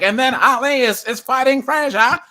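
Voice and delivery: loud, high mid-Atlantic fight commentator; mid-Atlantic accent. Acted. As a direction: call the boxing match in a mock mid-Atlantic accent. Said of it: "everyone had this Mid-Atlant-, Atlantic accent".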